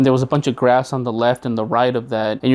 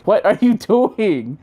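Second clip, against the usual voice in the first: laughing mom; 7 semitones higher, slower than usual. Retold or imitated. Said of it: "my mom was just laughing her ass off".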